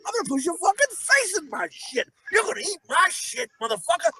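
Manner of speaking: high-pitched hammed-up ranting; mock rage. Co-host as Slapdog raging at the kid who pushed, mock rage. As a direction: rant as a wildly overprotective mother, voice way up. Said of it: "Jeez, Slapdog, you gotta calm down".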